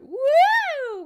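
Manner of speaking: loud, high, drawn-out "woo"; long soaring wheee-style whoop; playful whoop. Pretend. Demonstrating flapping arms, flying away.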